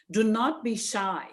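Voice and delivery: normal talking voice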